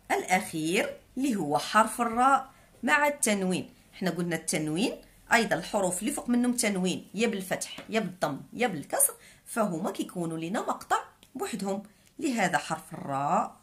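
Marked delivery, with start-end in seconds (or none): none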